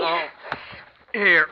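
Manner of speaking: loud, high call into the wind. Acted. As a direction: shout it so she can find you. Calling out in the snowstorm.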